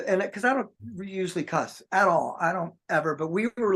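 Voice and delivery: ordinary delivery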